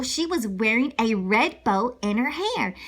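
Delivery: plain reading voice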